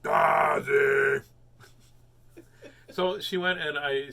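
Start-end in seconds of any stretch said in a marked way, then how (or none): 0.0-1.2 loud shouted call of Tazzy; called-out name. Retold or imitated. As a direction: yell the name across the yard. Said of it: "does this sound like you?".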